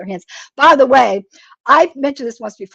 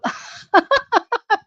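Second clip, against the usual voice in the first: high burst of laughter; 11 semitones higher than usual. Real, not acted. Laughs at chicken noodle soup.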